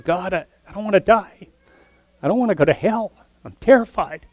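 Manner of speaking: high-pitched mock-terrified plea to God. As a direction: cry out to God in terror, half acted. Preacher voices Washer crying out.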